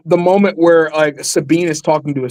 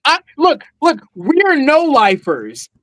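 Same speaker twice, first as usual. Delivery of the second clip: animated quote; 9 semitones higher than usual. Retold or imitated. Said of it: "And I'm like".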